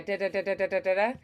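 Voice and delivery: quick tongue taps on "da da da"; fast light "da" syllables tapped in a row; rolled r exercise. Shown as a demonstration. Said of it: "Or, like, a really fast L".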